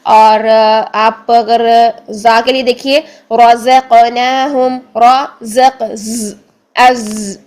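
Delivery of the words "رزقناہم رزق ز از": slow رزقناہم, then isolate a long ز; sustained voiced whistle on the z of از; buzzing za. Shown as a demonstration. Teacher showing za's whistle, رزق slow, ز held. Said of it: "سیٹی بھی ہونی چاہیے".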